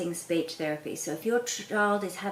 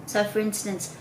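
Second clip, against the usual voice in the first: ordinary delivery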